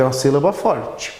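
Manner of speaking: usual speaking voice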